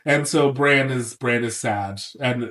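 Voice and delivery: usual speaking voice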